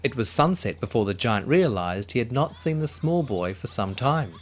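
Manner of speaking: usual speaking voice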